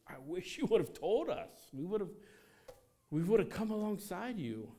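wishing they'd been told. Retold or imitated. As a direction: play the hurt friend who wasn't told, voice up high. Frustrated life-group member voiced.

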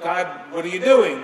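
loud, alarmed cry to God; acted panic, pushed out hard; panicking. Disciples crying to God in the storm, mock panic. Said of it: "and they're panicking".

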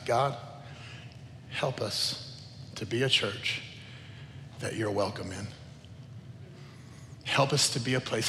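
low, soft plea to God; hushed, reverent asking; hushed prayer. Retold or imitated. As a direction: pray it quietly and low, with pauses. Heartfelt prayer retold to congregation.

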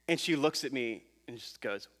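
usual speaking voice

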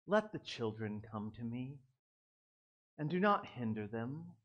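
hushed, placid Bible-reading Jesus; gentle storybook Jesus. Acted. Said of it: "read it like you would think Jesus would sound".

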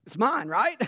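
put-on high, loud eager voice of a young daughter; excited daughter. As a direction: daughter hearing about the truck, squealing eagerly. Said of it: "Addie's like".

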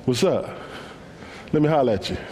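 low, slick pickup line; smooth street Jesus. Pretend. Jesus chatting up the woman at the well, low and smooth. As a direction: holler at her like a smooth guy.

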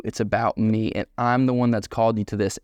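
normal talking voice

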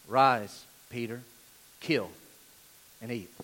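lightly voiced divine command; voice from heaven. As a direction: give each command on its own, with long pauses. Voicing the voice from heaven.

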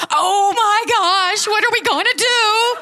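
panicked Moses. Retold or imitated. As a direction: play a frantic leader losing it. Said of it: "Moses is turning to God and go".

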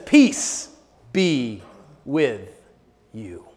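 slowing, fading to very soft; Jesus calming frightened disciples; calm reassurance. Retold or imitated. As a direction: settle them word by word.

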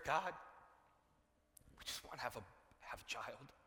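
soft, low, halting plea; quiet prayer. Acted. Preacher as Zechariah pleading with God for a child, hushed. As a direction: drop to a hushed, private prayer. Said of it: "He's continuing to pray that prayer".

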